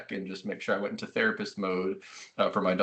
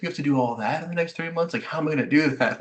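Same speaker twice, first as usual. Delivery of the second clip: mock overwhelmed; 6 semitones higher, faster than usual. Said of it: "that was my emotional experience".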